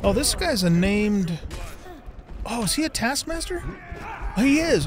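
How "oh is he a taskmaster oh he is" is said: high, eager gasp of discovery; excited surprise. Real, not acted. Player spotting a named taskmaster, excited surprise. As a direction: jump up in pitch, thrilled to spot him.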